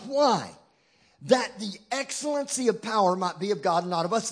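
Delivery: ordinary talk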